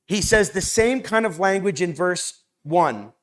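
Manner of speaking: ordinary talk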